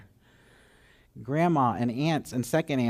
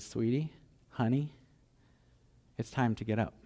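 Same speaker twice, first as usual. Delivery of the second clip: put-on softer, coaxing a sleeping child; gently, like a parent at the bedside; tender wake-up; 6 dB quieter than usual. Jesus waking the girl tenderly.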